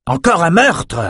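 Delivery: high, exasperated exclamation; agacé. A narrator's reading. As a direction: exclaim in annoyance, pitch jumping up. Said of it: "s'agaça Maxime". (exclamation agacée, voix qui monte)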